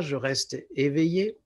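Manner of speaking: ordinary talk, nothing marked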